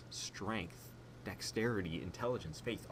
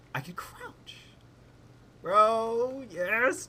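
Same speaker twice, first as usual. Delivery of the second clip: excited; 10 semitones higher, 4 dB louder, slower than usual. Real, not acted. Thrilled at crouching.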